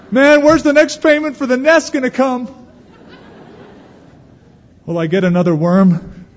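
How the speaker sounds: high, loud fretting little bird; worried bird voice. Pretend. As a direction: play an anxious bird fretting over bills.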